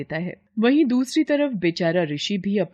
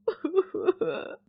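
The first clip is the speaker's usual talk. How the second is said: high giggle; 11 semitones higher, 4 dB quieter than usual. Acted. After her drunken song.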